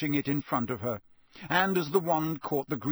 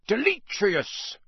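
raised, louder, very slow shout; shouted spell; 8 semitones higher, 5 dB louder, much slower than usual. A narrator's reading. Official dispelling the skull, shouting. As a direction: fling the spell out. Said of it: "Mister Diggory shouted".

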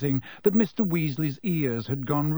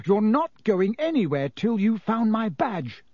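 high, louder yell; 7 semitones higher, 3 dB louder than usual. A narrator's reading. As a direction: yell like a furious prefect. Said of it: "yelled Percy".

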